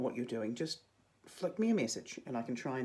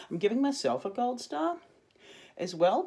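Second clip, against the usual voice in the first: excited; 9 semitones higher, 8 dB louder than usual. Real, not acted. Teacher cheering herself on.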